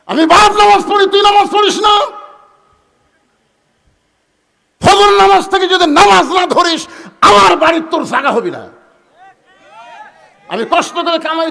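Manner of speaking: very loud, high shouted scolding; thundering father's tirade, pitched high; jihad-style scolding. Shown as a demonstration. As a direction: roar at a lazy son like a battle speech. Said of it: "জিহাদের সুরে বলা লাগবে".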